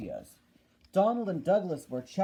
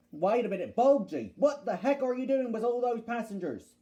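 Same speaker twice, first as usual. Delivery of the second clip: higher, indignant engine; indignant challenge; 5 semitones higher, 4 dB louder than usual. Acted. Duck demanding answers from Bulgy about the passengers, indignant. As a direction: demand an answer, pitch climbing.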